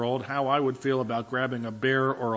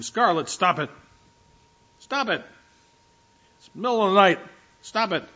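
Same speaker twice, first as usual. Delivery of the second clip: raised, higher voice calling off the dog; scolding the dog; 6 semitones higher, 5 dB louder than usual. Retold or imitated. Owner scolding her barking dog at night, raised voice. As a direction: snap at the dog to quit barking.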